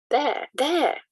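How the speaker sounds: high, eager call of "there"; urgent cry. A narrator's reading. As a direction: point and cry out in excitement. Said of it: "I cried".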